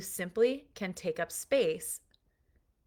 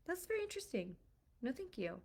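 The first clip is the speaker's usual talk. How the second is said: talking to a dog; 5 semitones higher, 11 dB quieter, faster than usual. Real, not acted. Talking to the dog mid-lesson.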